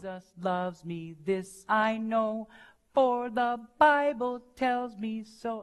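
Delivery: put-on sung lightly like a child, accent on "loves" and "Bible"; children's song with accents on the first and third beats; accent on beats one and three. Shown as a demonstration. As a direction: sing "Jesus loves me" like a child would, leaning on "Bible". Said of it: "podemos poner también el acento en el primer y el tercer tiempo".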